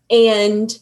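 usual speaking voice

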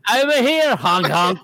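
lightly voiced silly clown; high goofy clown entrance. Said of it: "a failed Italian clown".

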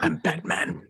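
Batman voice. Retold or imitated. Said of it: "There's a little Batman in there".

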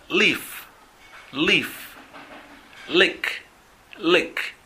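leaf and lick said high and clear, each twice; English pronunciation model. Shown as a demonstration. Teacher modelling words for students to repeat. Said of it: "escuchen y repitan".

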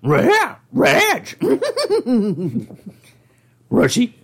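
high Shaggy voice; goofy cartoon slacker voice. Continues cartoon voice.

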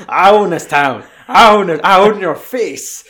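loud, gloating rich villain; gravelly old tycoon. Retold or imitated. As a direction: bellow it like a smug town boss. Villain Wesley voiced mocking victim.

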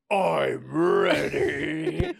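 loud, high, hugely drawn-out; hammed-up, slow bellow; over-the-top readiness. A narrator's reading. Repeated line escalates to absurd peak.

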